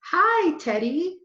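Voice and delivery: put-on girl cooing over a cute puppy. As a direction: coo at the little puppy. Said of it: "she said".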